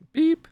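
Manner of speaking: high-pitched scanner beep; imitated checkout beep. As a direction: mimic a store scanner with a short high beep. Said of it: "It's just like, beep".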